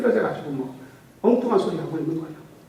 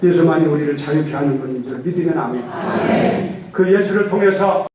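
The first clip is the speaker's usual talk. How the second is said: loud fervent sermon call; full-force preaching; 6 dB louder than usual. Acted. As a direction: preach it out to the congregation with fervor.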